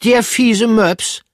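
shocked outrage at the pug; outraged surprise. Acted. Pomme de Terre is shocked, high and loud.

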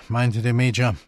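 ordinary delivery, nothing marked

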